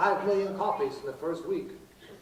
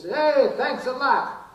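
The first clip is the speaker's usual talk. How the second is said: yelling down; 8 semitones higher, 3 dB louder, slower than usual. Retold or imitated. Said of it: "she yells down and says".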